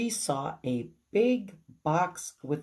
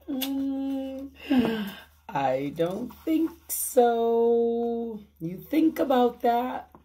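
1 knowing teacher; 6 semitones higher, slower than usual. Real, not acted. Teacher asks children to think.

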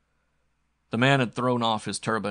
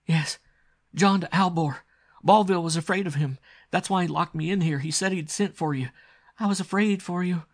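put-on high, loud, frightened girl; urgent and pitched high with dread; new terror; 9 semitones higher, 7 dB louder than usual. Captive girl telling her lover whom she fears, terrified. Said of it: "Her eyes flared with new terror".

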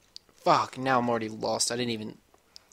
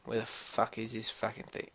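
ordinary talk, nothing marked

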